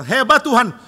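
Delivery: loud, high sarcastic cheer of the disciples; high and loud mock praise; disciples marvelling. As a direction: cry out mockingly impressed. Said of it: "Lalu murid-murid bilang".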